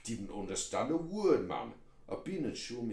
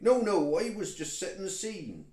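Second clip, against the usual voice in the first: high West Country protest, loud start; indignant protest; 5 semitones higher, 6 dB louder than usual. Acted. Toad defends his scene setting to hecklers, indignant. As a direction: burst in to defend your storytelling.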